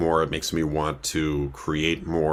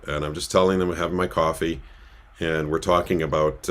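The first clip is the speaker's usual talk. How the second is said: normal talking voice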